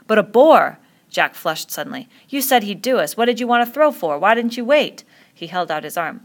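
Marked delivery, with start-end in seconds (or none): 0.1-0.8 loud, high exclamation; voice running up. A narrator's reading. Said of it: "Ralph's voice ran up". 2.3-5.0 high, loud string of reproaches; angry accusation. Acted. Flushed boy accuses the other.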